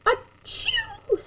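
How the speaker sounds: high playful fake sneeze; squeaky pretend achoo; mock sneeze. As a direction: act out a sneeze for the kids. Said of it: "nodded glumly and sneezed".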